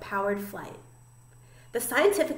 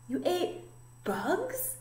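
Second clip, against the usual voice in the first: high, softer incredulous question; mildly stunned, pitch lifting on the question; stuttering disbelief; 8 semitones higher, 7 dB quieter, much slower than usual. A narrator's reading. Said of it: "stuttered one".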